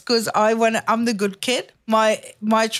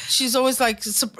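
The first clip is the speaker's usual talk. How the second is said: ordinary talk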